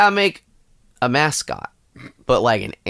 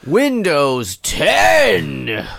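mock announcer; 4 semitones higher, 4 dB louder, much slower than usual.

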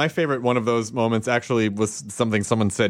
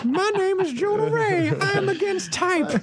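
fat voice. Pretend. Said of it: "Who has the fattest voice? Go".

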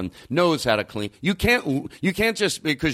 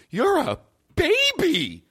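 much higher, slow, mocking "You're a baby."; slower than usual. Shown as a demonstration. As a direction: talk down like to a baby, pitched way up. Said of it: "Sure, my voice".

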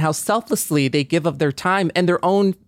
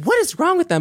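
much higher, incredulous mock exasperation; exasperated inner outburst; 14 semitones higher, faster than usual. About a driver screaming in traffic, a mock exasperated squeal. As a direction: toss it off like a baffled driver stuck in traffic. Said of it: "And I'm just like".

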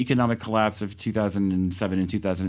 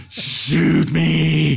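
loud, higher, exasperated plea; 6 semitones higher, 7 dB louder than usual. Retold or imitated. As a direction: groan it out, fed up. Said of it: "I'm just like".

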